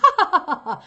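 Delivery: high fake burst of laughter; mock the same canned laugh; forced laugh on cue. Shown as a demonstration. Every actor laughing on cue, a high fake laugh. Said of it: "every single actor comes into the room".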